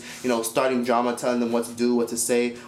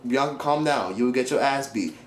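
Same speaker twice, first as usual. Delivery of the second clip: ordinary delivery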